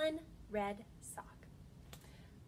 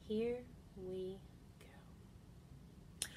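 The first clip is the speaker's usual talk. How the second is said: whisper; 4 dB quieter, much slower than usual. Acted. Whispered lead-in.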